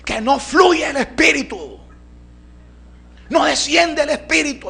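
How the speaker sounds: high shrill-pitched yell of an outraged medium; furious high-pitched shouted command, hammed-up; shouted scream. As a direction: scream the order at the top of your voice. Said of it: "esa señora meta un grito".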